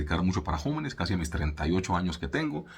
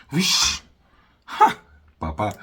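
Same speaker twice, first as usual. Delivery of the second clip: mock teen scoff; 3 semitones higher, 6 dB louder, much slower than usual. Mocking teens grumbling at parents.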